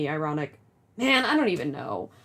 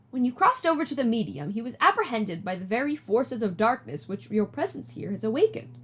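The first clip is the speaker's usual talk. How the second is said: ordinary talk, nothing marked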